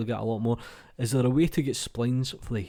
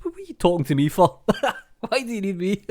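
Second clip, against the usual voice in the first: high, loud mock complaint breaking into laughter; playfully protest, then laugh; mock indignation; 9 semitones higher, 6 dB louder than usual.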